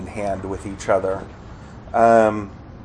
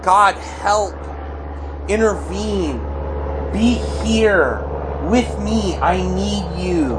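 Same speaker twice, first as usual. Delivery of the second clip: high, halting plea; crying out; 7 semitones higher than usual. Acted. Struggling believer asking God to intervene, high plea. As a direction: plead with God phrase by phrase. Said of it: "you're crying out".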